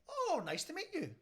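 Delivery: very high, polite greeting; imitated wife being sociable. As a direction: greet a new neighbour in a high voice. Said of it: "says my wife".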